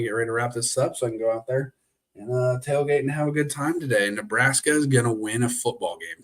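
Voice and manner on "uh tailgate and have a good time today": ordinary talk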